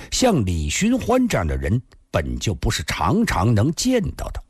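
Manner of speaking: ordinary delivery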